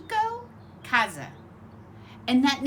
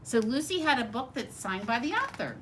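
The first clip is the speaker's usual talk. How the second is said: plain reading voice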